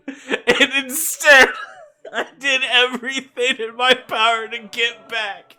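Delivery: laughing. Acted. Laughing at his own realization.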